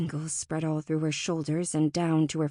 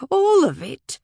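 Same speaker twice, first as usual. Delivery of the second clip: high, loud, indignant cry; exasperated outburst; 8 semitones higher, 9 dB louder than usual. A narrator's reading. Fae gestures at everything around him, loudly exasperated. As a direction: burst out with sweeping blame.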